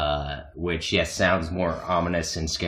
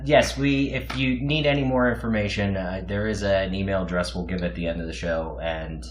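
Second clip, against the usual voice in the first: normal talking voice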